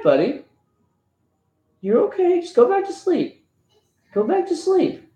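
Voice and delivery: dad calming his son after a nightmare, tender. Real, not acted. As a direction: coax him gently back to sleep.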